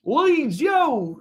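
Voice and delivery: drawn-out, high-pitched mock Leeds accent; exaggerated Leeds accent, stretching the words; Archie Gray. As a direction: put on a teenage lad from Leeds. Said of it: "that's what he probably sounds like".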